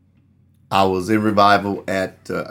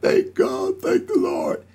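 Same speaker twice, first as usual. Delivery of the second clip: high, tearful voice breaking on thanks; retold crying; slower than usual. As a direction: voice her crying through the words of thanks. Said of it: "She was crying".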